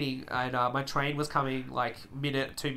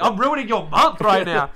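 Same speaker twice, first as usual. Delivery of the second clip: mock trash talk; 5 semitones higher, 9 dB louder than usual. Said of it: "just go off on the kid and be like".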